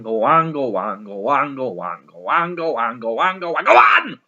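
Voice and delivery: sing-song nagging, run together; hammed-up housekeeper insisting; relentless coaxing. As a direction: wheedle on and on, bursting out at the end. Housekeeper nagging guest to drink.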